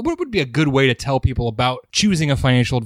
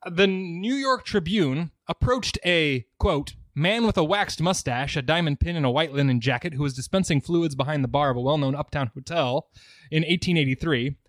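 soft reading aloud; 6 dB quieter than usual. Acted. Reads a historical newspaper passage.

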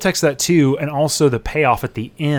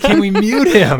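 exasperated; 7 semitones higher, 6 dB louder than usual. Real, not acted. Exasperated at heckler.

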